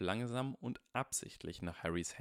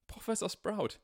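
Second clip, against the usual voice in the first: high, loud cry of relief; relieved cry; 13 semitones higher, 4 dB louder than usual. Acted. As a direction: call out, hugely relieved. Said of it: "klang so erleichtert".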